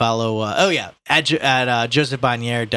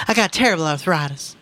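mother voice; 8 semitones higher than usual. Acted. Mrs Reilly voiced in reading.

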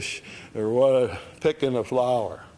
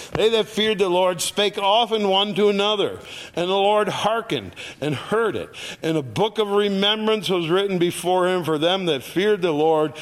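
higher, louder preaching of the verse; preached scripture; 6 semitones higher, 5 dB louder than usual. Acted. Preacher reading Malachi to his congregation, at full force.

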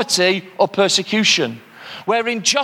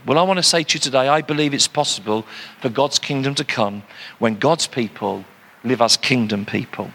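normal talking voice

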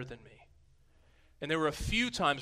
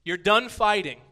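loud, much higher victorious command; the Lord silencing the nations, loud and triumphant; commanding shout; 7 semitones higher, 11 dB louder, slower than usual. Acted. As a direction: declare the nations beaten. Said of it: "the Lord coming, saying".

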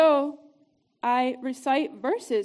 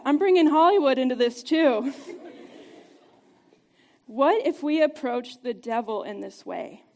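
ordinary talk

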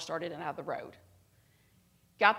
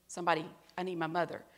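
ordinary talk

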